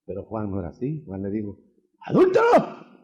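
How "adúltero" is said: high, loud cry of adúltero; shouted accusation. Acted. Preacher voicing John shouting at the adulterous king. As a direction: denounce the king to his face.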